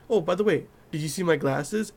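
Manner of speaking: usual speaking voice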